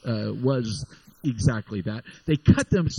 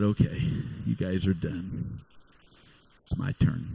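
calm; 11 semitones lower, 4 dB quieter than usual. Retold or imitated. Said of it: "Elijah said".

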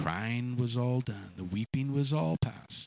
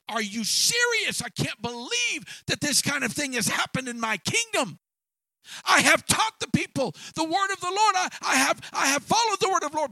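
furious shouting, much higher and louder; angry king; 5 dB louder than usual. Acted. David outraged at Nathan's story, shouting angrily. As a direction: rage at the injustice, shouting. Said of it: "And David was angry".

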